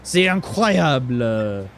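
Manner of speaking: put-on theatrical French accent. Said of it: "the French guy's here".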